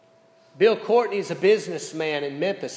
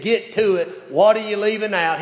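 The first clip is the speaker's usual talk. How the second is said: louder, higher curt demand; blunt, lost cool; 5 semitones higher, 8 dB louder than usual. Retold or imitated. Coach demanding his captain tell him, blunt and louder. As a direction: snap it out like a coach losing patience. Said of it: "lost his cool with Larry a bit and spoke even a little more bluntly".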